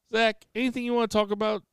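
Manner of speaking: high, fast mock host voice; hammed-up host filling dead air; mock talk-show host. Teasing host fills air.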